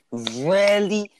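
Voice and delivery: squeaky, high incredulous really; high-pitched disbelief. Real, not acted. Repeating in mounting disbelief.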